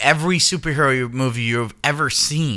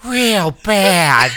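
a hint of the diva voice; 4 semitones higher, 3 dB louder than usual. Tag line in the voice.

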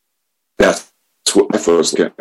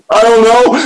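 a much higher, louder, squeaky "I don't know"; sheepish squeak; 7 dB louder than usual. Retold or imitated. Answering the sponsor about harming capitalism, squeaky and sheepish. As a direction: play his younger self, small and helpless.

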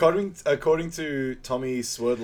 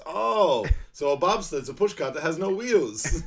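acted realisation; 6 semitones higher, 5 dB louder than usual. Quoting Sanka learning what a bobsled is, high mock realisation. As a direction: play the penny dropping, amused.

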